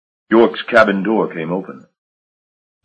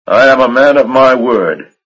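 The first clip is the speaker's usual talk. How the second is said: firm, proud and a little louder; stoutly; 6 semitones higher, 7 dB louder than usual. Acted. Marsh assuring York he keeps his bargain, stout. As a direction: plant your feet and vouch for yourself. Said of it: "Marsh said stoutly".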